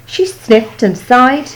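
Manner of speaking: usual speaking voice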